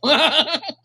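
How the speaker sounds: high, loud burst of chuckling; character's chuckle. Acted. Renimal reacting to the others, loud high chuckle. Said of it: "Renimal is just going to chuckle".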